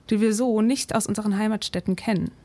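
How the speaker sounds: ordinary talk, nothing marked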